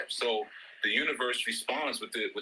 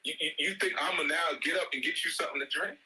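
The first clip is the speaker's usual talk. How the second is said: higher, mock-outraged parent, fast at the end; exasperated parent rant; 7 semitones higher than usual. Parent mock-scolding a child who says he is thirsty. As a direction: scold the lazy kid, half-laughing.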